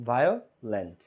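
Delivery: Violent in two beats, Veo, gap, lent; split into syllables. Shown as a demonstration. Teacher repeating the syllable count for students, violent split in two. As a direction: pull violent apart at the syllable break, pitch dropping. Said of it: "উচ্চারণ করতে কয়ভাবে ভাঙা যায়".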